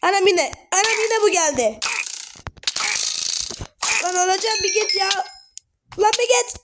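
real fear as the mob comes back; frightened pleading. Real, not acted. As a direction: whine at it to leave you alone. Mob returns to attack.

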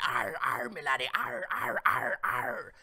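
a hint of a cartoon pirate growl. Joking that the hit woman now talks like a pirate, light pirate voice. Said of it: "looking like a pirate".

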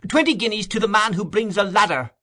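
high-pitched calling out; urgent offer cried out high; furious cry above crowd. A narrator's reading. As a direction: raise the voice over a mob. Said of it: "cried beneath the window in a voice that rose above all others".

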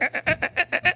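very high, thin mock whimper of a puckering kid; sour lemon grimace. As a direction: squeak it like a toddler tasting a lemon. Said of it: "Little guy's like".

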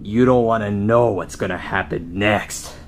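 slow, low and menacing; low menacing threat. Acted. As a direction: threaten him darkly. Luigi threatens Mario.

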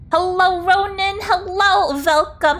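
high, drawn-out hello; excited greeting. Real, not acted. Streamer welcoming a viewer who just arrived, excited greeting. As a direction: greet them in a high, cheery lilt.

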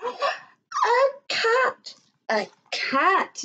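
put-on high startled child cry; alarmed yell. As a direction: call out in fright. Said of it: "Neeraj yelled".

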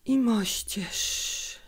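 slow, drawn-out, barely audible whisper; a sick mother whispering with effort; very quiet whisper. A narrator's reading. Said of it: "szepnęła tak cicho".

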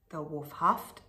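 ordinary delivery

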